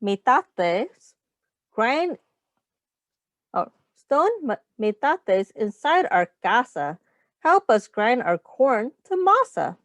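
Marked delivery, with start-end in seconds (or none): none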